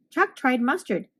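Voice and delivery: usual speaking voice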